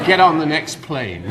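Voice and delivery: jokey warning, laughing as it lands; laughing punchline. Retold or imitated. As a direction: deliver the joke's payoff with a laugh. Punchline of joke to wife.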